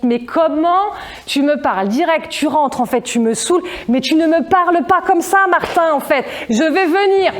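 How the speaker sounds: mock telling-off that climbs in pitch; acted parental scolding. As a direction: scold with rising exasperation. Parent scolding son, acted quarrel.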